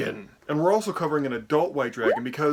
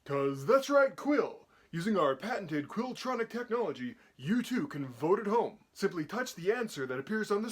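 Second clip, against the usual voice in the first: high, excited game show host; 7 semitones higher, 4 dB quieter than usual. Acted. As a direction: hype it up like a TV host.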